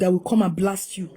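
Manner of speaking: ordinary delivery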